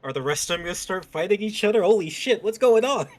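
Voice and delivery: surprised loud outburst; excited. Real, not acted. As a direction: shout it out in disbelief.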